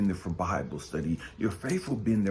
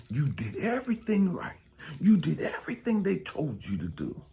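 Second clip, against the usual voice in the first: impassioned preaching; 7 semitones higher than usual. Acted. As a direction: hammer each name home from the pulpit. Preacher builds up about betrayal.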